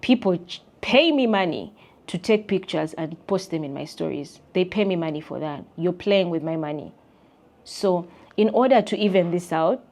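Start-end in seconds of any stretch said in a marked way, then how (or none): none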